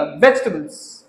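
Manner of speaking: वेजिटेबल्स as low "VEJ-tuh-bulz"; reduced vegetables pronunciation. Shown as a demonstration. Teacher repeating for students, वेजिटेबल्स as "VEJ-tuh-bulz". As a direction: skip the "i" in वेजिटेबल्स. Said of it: "वेज ही नहीं हैगा".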